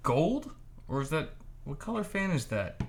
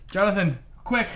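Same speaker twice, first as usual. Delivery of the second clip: urgent; 6 semitones higher, 4 dB louder than usual. Real, not acted. Hurrying, needs to pee.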